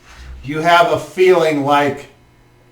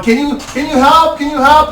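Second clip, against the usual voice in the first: higher, anxious asking; pleading call for help; 10 semitones higher, 4 dB louder than usual. Acted. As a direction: beg for help to deaf ears. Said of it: "you're sitting there going".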